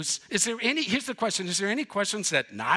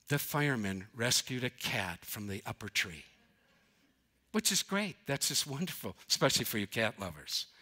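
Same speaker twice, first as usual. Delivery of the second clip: quiet, low feel-good news read; soft news anchor; 8 semitones lower, 8 dB quieter than usual. Retold or imitated. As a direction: wrap up the fluffy cat story. Mimicking closing feel-good news story.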